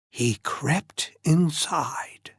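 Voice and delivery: slow hushed whisper; creeping whisper. A narrator's reading. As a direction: whisper it as if sneaking in. Narrator creeping quietly.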